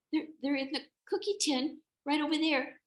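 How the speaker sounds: put-on high, loud child voice; squeaky flustered child; nervous kid. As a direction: stammer out the directions as a little goat. Frightened kid directs wolf.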